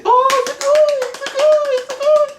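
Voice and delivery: very high, loud gushing praise; gushing Japanese lady. Retold or imitated. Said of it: "the Japanese like".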